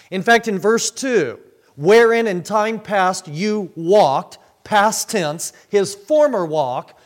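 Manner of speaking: loud, high, emphatic pulpit delivery; forceful preaching. Real, not acted. Preacher expounding verses on the sinner's walk, forceful preaching. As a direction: drive each phrase home at full voice.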